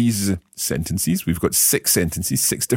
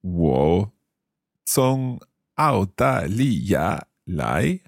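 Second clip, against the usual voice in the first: very slow, syllable by syllable; slow learner's repeat; much slower than usual. Shown as a demonstration. Learner repeating the teacher's Chinese phrase, very slowly. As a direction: draw out each tone carefully. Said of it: "I'll try repeating them".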